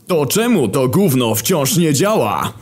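high, drawn-out angry outburst; pissed-off. Acted. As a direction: rage at a thing that won't work. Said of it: "Wojna był wyraźnie wkurwiony".

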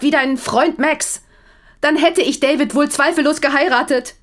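high, loud, slowed words in anger; wife shouting her cruel answer; furious outburst. Acted. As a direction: hurl the truth at him. Wife finally shouts confession.

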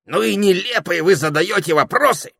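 loud, high, irritated parrot; scornful. A narrator's reading. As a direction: scold them for silliness. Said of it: "отрезал попугай".

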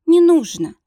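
tearful plea. Acted. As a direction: beg him through tears, voice rising. Heroine begs through tears.

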